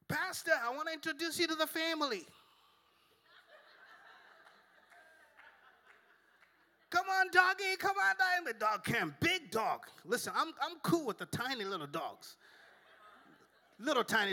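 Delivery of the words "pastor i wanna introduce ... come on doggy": high sweet lady voice; imitating the lady. Dog owner introducing pastor to the family, high sweet. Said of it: "she was just like".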